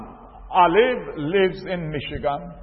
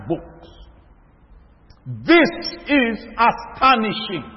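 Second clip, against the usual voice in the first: raised, slow preacher's build to the congregation; preaching; 4 semitones higher, 6 dB louder, slower than usual. Acted. Preacher rousing his brothers and sisters, slow and raised. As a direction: lean into every phrase like a pulpit sermon.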